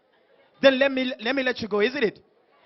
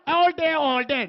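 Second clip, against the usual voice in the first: put-on slow, higher parody of an American accent; mock American accent; 5 semitones higher, slower than usual. Host mocking the DJ's answer, mock American accent. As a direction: mimic his American accent, drawn out. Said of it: "The accent".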